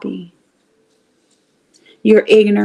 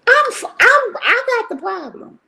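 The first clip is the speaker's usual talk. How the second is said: higher, stammering admission; high, halting "I got the problem"; sheepish confession; 12 semitones higher than usual. Retold or imitated. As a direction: own up to it, flustered and a little embarrassed. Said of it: "I'm like".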